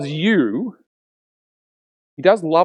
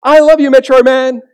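higher, louder call from the crowd; lone fan yelling; 10 semitones higher, 9 dB louder than usual. Retold or imitated. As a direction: yell it out from the back of the crowd. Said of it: "yells out".

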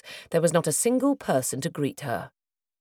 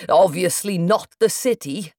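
plain reading voice

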